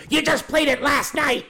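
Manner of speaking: very high-pitched mock exasperation; exasperated retort. Aunt correcting her sister during Uno, mock exasperated. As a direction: pitch it way up, protesting like a fed-up aunt.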